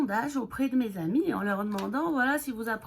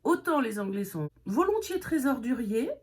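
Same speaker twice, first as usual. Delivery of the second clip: normal talking voice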